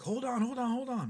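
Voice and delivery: high-pitched urgent mimicry of mom; mom's alarmed outburst. Mom warns sister at the wheel as the other car inches in, urgent. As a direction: imitate a panicking mother, pitch shot way up. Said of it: "my mom then gets really trigger happy".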